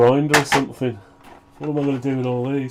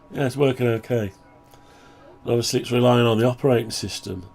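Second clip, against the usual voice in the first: ordinary talk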